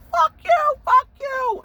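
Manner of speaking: put-on very high, loud drunken screech; drunk yell at cops. Rhoda screaming at the cops, high screech. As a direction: scream it at the cops like a wasted idiot.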